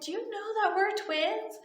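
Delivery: put-on high little-girl question; little kid. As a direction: pipe it up like a small child asking. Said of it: "a little kid".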